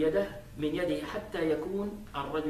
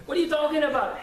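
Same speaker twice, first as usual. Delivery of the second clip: high, loud challenge for comic effect; mock outrage; 10 semitones higher, 6 dB louder, faster than usual. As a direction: act the indignant hothead. Acted rejection of an apology.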